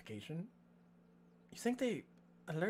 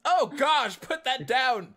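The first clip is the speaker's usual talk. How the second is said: loud, high cry of alarm; alarmed call; 9 semitones higher, 10 dB louder, slower than usual. Acted. Character suddenly telling someone to put that down, loud alarm. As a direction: shout it out, startled and urgent.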